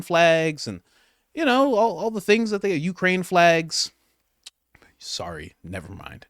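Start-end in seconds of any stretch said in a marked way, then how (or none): 5.0-6.3 unvoiced sorry, barely there; whispered aside. Real, not acted. Reactor catching his own slip, whispered sheepish aside. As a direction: whisper the correction to yourself. Quick whispered self-correction.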